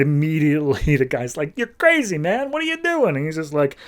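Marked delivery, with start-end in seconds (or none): 1.6-3.2 high panicked yelp; freaking out as the stakes come out; alarmed panic. Retold or imitated. Vampire victim panicking at Targa, high alarmed cry.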